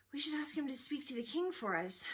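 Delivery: high, voiced, mildly fearful suggestion; understated storybook fear, not truly whispered. A narrator's reading. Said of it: "whispered fearfully to his father".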